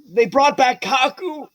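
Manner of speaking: high, drawn-out exclamation; excited announcement. Acted. Kappa elder hears of a return, excited. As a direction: announce it in high-pitched delight.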